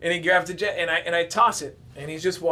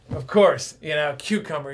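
ordinary talk, nothing marked

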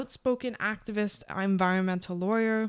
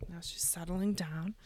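soft unvoiced whisper; 7 dB quieter than usual. Real, not acted. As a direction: whisper so the dog stays calm. Quieting near the barking dog.

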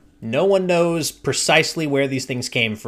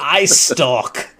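grossed-out imitation of a player; mock retching disgust; 3 dB louder than usual. Imitating sister reacting to the monster description, queasy disgust. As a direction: force the words out as if queasy. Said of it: "like, retch a little bit".

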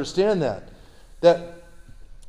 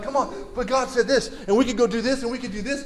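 eager, higher-pitched urging; all excited; 5 semitones higher than usual. Acted. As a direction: plead with them, bubbling with enthusiasm. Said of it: "I'm all excited".

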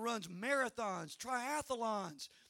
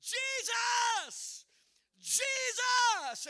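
high, loud cry of the name; screaming Jesus; 13 semitones higher, 7 dB louder, much slower than usual. Retold or imitated. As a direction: scream the name out alone on the road. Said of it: "I started screaming out there by myself".

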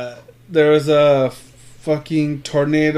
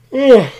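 loud, high-pitched retold groan; exasperated groan; 11 semitones higher, 11 dB louder than usual. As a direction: let out a loud "ugh". Retelling his reply on the phone.

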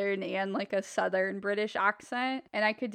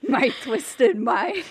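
slow, louder mock-sinister villain line; 5 dB louder, slower than usual. Joking to cohost about her twisted mind, hammed-up villain. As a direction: hams up being a criminal mastermind.

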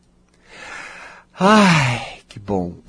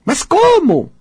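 a much higher, louder baffled cry of the inner voice; the doubting voice cries out in disbelief; alarmed inner voice; 6 dB louder, much slower than usual. Acted. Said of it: "o que voz tá falando".

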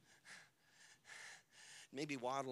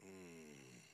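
low disgusted groan; a low, weary hum of disgust; 19 dB quieter than usual. Acted. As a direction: groan in frustration. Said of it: "Scripture says Jesus groaned".

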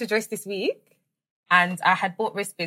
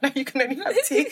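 laughing refusal; 6 semitones higher than usual. Retold or imitated. Quoting herself refusing sister, laughing.

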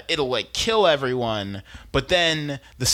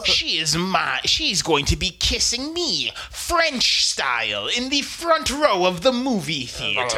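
high, loud, slow hammed-up gloat; gloating villain; 3 semitones higher, 5 dB louder than usual. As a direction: ham up a smug cartoon villain. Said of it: "gloating villain monologue".

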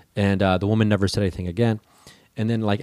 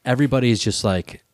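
normal talking voice

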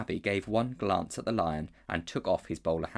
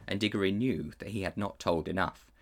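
ordinary delivery, nothing marked

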